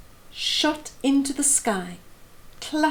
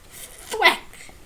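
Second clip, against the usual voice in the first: thwack sound; 11 semitones higher than usual. A narrator's reading. Sound effect in story.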